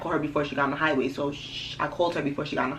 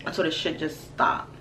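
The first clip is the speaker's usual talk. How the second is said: ordinary talk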